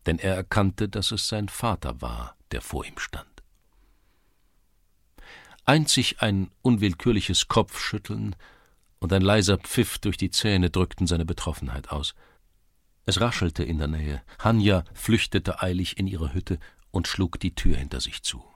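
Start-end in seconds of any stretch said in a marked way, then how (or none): none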